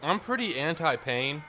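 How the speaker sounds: loud; emphatic. Real, not acted. Loud emphatic self-declaration.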